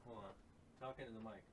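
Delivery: distant, off-mic voice; speaking away from the microphone; turned away from mic. Real, not acted.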